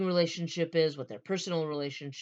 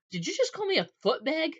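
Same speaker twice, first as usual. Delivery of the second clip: high, incredulous rising question; 12 semitones higher than usual. Acted. Man reacting to being called a name, incredulous. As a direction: pitch it up in puzzled disbelief. Acted reaction to odd insult.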